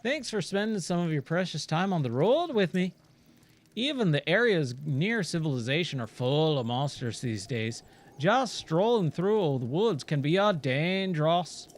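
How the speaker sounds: put-on broad, lilting mongrel accent for a merchant; hammed-up, unplaceable accent; Giro in muddled accent. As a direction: send them off in a jumble of accents. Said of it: "I don't know what nationality Gyro is".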